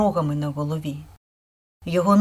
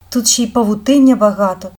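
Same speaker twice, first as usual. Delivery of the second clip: louder, mildly whiny complaint; whining; 3 semitones higher, 6 dB louder than usual. A narrator's reading. Younger sister complains about the cobwebs, whining. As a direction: whine like a little sister. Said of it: "прохникала молодша сестра".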